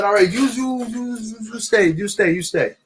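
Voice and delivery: high-pitched mock Italian cop, repetitive; bad Italian accent. Italian cop telling the driver to stay put, bad Italian accent. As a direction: order someone to wait in a hammy Italian accent. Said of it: "I'm doing a horrible impression".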